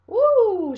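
high, loud "woo"; whoop. Real, not acted. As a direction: let out a sudden high whoop. Reader reacts while showing illustrations.

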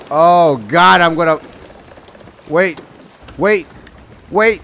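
from nervous wait to loud alarmed cry; rising panic. Real, not acted. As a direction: panic building to a shout as the ship goes down. Panicking as ship crashes.

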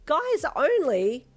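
high, loud, drawn-out disbelief; incredulous reading. Acted. Reading the poster's rule aloud, loudly incredulous. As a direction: stretch it into an outraged question.

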